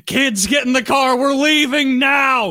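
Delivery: urgent mom order. Pretend. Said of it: "I would be".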